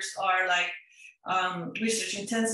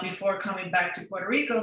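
usual speaking voice

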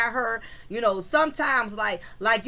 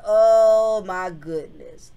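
slow, louder drawn-out gasp of disbelief; shocked exclamation; 2 semitones lower, 6 dB louder, much slower than usual. Retold or imitated. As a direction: stretch it out in shock. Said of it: "I said".

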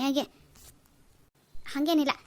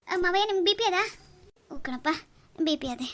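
ordinary talk

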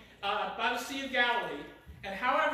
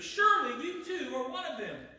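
higher-pitched, insistent accusing; pointed accusation; 5 semitones higher than usual. Acted. Bystanders confronting Peter by the fire, accusing. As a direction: press the charge on him.

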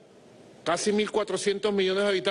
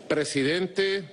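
usual speaking voice

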